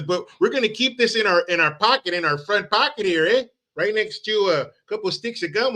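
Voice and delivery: mock Canadian folksiness. A Canadian leader offering Americans maple candy, in a light mock-Canadian voice. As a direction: play the folksy Canadian uncle handing out candy. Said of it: "And he says".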